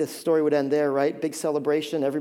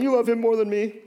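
higher-pitched sulky child imitation; mock temper tantrum; 4 semitones higher than usual. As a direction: mildly whine like a jealous kid. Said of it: "little temper tantrum that sometimes we have".

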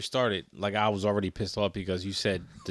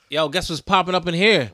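louder, higher, hyped-up announcement; mock call-out to the room; 6 semitones higher, 7 dB louder than usual. Friend pretending to announce the mold to customers, loud and hyped. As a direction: call it out to the whole restaurant.

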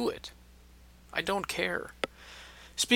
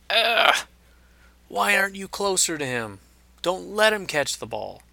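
exasperated fan yelling; 7 semitones higher than usual. Retold or imitated. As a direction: yell at the corner like a fed-up fan.

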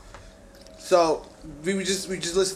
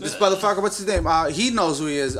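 usual speaking voice

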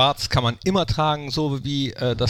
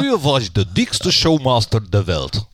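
Dutch showmaster impression; 3 semitones lower, 3 dB louder than usual. Quoting Harry Wijnvoord in his voice.

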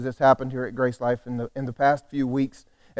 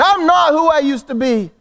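high, exuberant raised cry; mock screaming; 12 semitones higher, 7 dB louder than usual. Preacher acting someone shouting at the bathroom mirror, exuberant cry. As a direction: cry it out high, as if waking the house. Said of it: "standing in the bathroom screaming".

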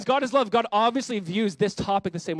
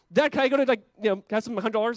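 put-on high, loud little-kid ask; pleading kid; 5 semitones higher, 5 dB louder, faster than usual. Child asking dad for a puppy, high eager kid voice. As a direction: beg dad like a small child.

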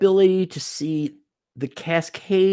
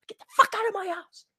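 mock girlfriend outburst; 8 dB quieter, faster than usual. Voicing girlfriend snapping.

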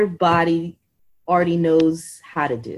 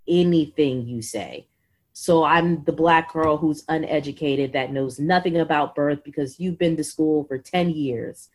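usual speaking voice

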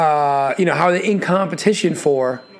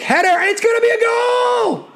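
goal call shout. Real, not acted. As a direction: scream the goal call at full pitch. Goal scored in live call.

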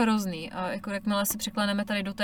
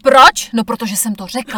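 mock strict parent, loud; bossy logic parent; 2 semitones higher, 15 dB louder than usual. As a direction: shut the child down: because I said so. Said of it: "takzvaní logici".

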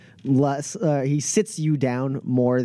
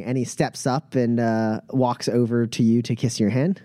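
usual speaking voice

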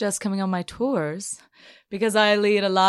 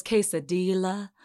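put-on Eastern North Carolina accent. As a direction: say quesadilla the way an old Eastern North Carolina mother would. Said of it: "a real genteel, like, Eastern North Carolina accent".

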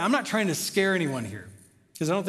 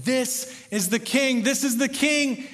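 higher, slow, emphatic acclaim; worshipful proclamation; 6 semitones higher, slower than usual. Acted. Disciples responding to Jesus in worship, raised slow proclamation. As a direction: proclaim it slowly, lifting the pitch. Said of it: "responding in worship saying".

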